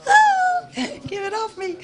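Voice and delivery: high panicked squeal, half laughing; shaking off a spider. Retold or imitated. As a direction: squeal it like something's crawling on you. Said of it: "It's like".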